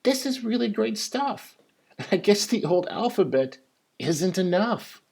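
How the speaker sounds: lightly voiced young boy, excited; a hint of an eager kid; boy's voice. A narrator's reading. Boy telling the narrator he loves the new letters, mildly eager. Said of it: "finally he said".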